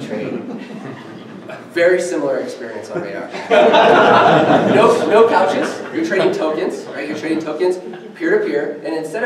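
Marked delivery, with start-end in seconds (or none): 3.3-4.7 a burst of open laughter; loud laugh. Real, not acted. Speaker laughing at his own couch joke, loud laugh. 4.7-5.8 laughing. Real, not acted.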